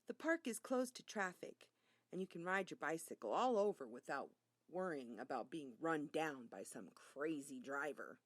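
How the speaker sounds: plain reading voice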